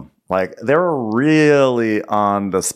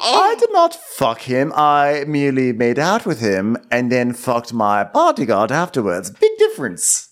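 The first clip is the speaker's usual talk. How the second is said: guilty denial. Acted. Princess denying the affair, guiltily overdone. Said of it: "in that totally guilty way".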